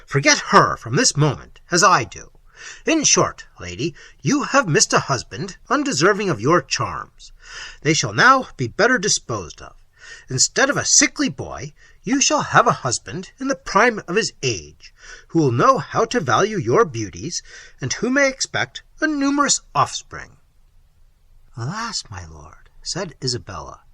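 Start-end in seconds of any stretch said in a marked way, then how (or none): 0.0-20.4 louder, commanding lord voice; a hard prince brushing off his son's death; a proud tyrant dismissing grief; Manfred, cold prince. Acted. Manfred pressing his suit. 21.6-22.7 alas. Acted. Isabella's sorrowful reply.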